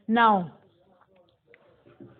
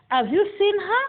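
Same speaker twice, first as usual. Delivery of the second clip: high question climbing to "her"; rising intonation; 8 semitones higher than usual. Shown as a demonstration. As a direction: lift the pitch right up on "her". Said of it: "Rising intonation".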